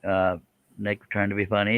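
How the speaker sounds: ordinary talk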